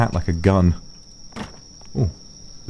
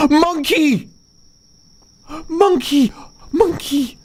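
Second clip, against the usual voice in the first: excited squeal; slower than usual. Real, not acted. Spotting a monkey in game.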